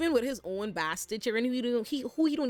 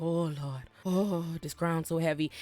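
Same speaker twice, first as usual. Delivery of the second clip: ghostly Viserys; 4 semitones lower than usual. Acted. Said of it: "He's like".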